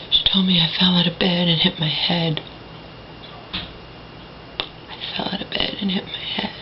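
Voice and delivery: hushed pre-dawn whisper. Real, not acted. Daughter recording at dawn, whispering. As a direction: whisper so the house stays asleep.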